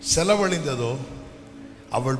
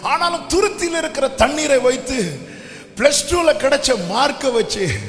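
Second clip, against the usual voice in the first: high, loud sermon with shouted peaks, climbing to a roar; fiery preaching; 12 semitones higher, 6 dB louder than usual. Acted. Pastor urging anxious parents, preaching at full force. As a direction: preach it hard, pleading with the congregation.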